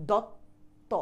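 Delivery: দত্ত broken into dot and to; দত্ত split at the double t; demonstrated pronunciation. Shown as a demonstration. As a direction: stop on the half ত of দত্ত, then release it fully. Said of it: "আমি যে উচ্চারণ করছি".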